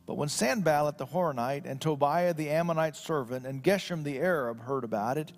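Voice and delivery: formal scripture read-aloud. Acted. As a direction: read the passage out solemnly from the pulpit. Reads the Bible passage aloud.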